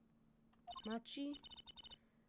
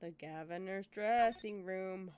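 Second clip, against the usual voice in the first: normal talking voice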